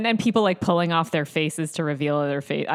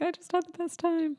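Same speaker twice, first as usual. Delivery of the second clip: much higher, softer, lightly voiced clueless fan; mock oblivious cheer; 12 semitones higher, 6 dB quieter than usual. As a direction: tease him as a happy oblivious fan. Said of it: "and you were like".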